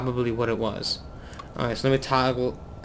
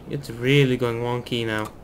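usual speaking voice

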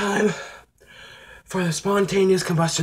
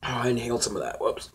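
ordinary delivery

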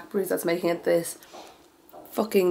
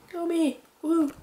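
mock cheer; 9 semitones higher, slower than usual. Real, not acted. Celebrating winning competition.

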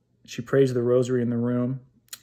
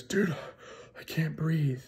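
high, strained and breathless, a touch quieter; gasping in fright; 5 semitones higher, 6 dB quieter, slower than usual. Retold or imitated. Friend waking at 3 AM tells him, breathless and scared. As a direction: gasp it out like the air is being squeezed from you. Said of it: "And he goes".

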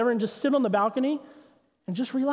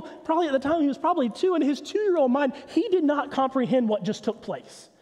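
ordinary delivery

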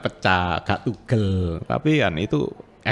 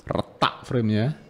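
usual speaking voice

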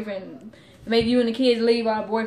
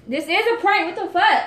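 raised, loud protest; higher and louder, fed up with being doubted; exasperated insistence; 6 semitones higher, 7 dB louder than usual. Real, not acted. Girlfriend protests to disbelieving boyfriend, exasperated and loud.

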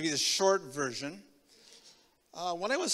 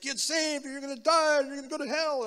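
high, loud mock hellfire preaching; hammed-up brimstone warning, pitched high; fire-and-brimstone preacher; 10 semitones higher, 8 dB louder than usual. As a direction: imitate the Baptist pastor warning them. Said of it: "in my day, it was like".

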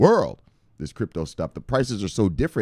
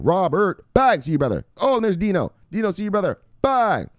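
hype shoutout; 9 semitones higher, 3 dB louder than usual. Acted. Shoutouts to viewers.

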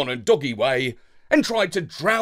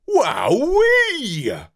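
high, loud amazed cry; wowed; 9 semitones higher, 5 dB louder than usual. A narrator's reading. Dog amazed by the speedy ship, loud cry. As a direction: cry it out in delight. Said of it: "I declared".

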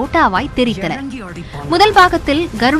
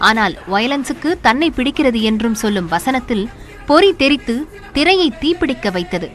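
ordinary delivery

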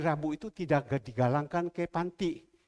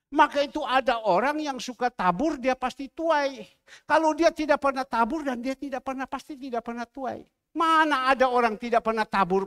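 raised preaching voice, driving the point; 7 semitones higher, 5 dB louder than usual. Acted. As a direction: preach it with conviction. Preaching sow and reap. (khotbah berapi-api tentang menabur dan menuai)

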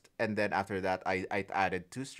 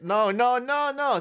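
excited no no; 10 semitones higher, 9 dB louder than usual. Real, not acted. Sudden correction, high and loud.

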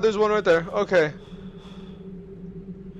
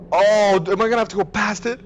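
normal talking voice

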